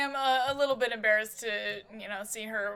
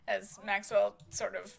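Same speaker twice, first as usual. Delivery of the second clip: normal talking voice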